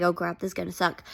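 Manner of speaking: soft, low and quick; a quiet retold dread while watching; hushed dread. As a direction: mutter the worry fast and softly. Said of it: "I'm like".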